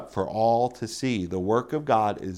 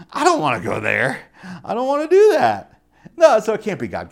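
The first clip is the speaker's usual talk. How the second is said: put-on loud, high childish refusal; hammed-up whining objection; mock protest; 7 semitones higher, 8 dB louder than usual. Acts out refusing God's voice.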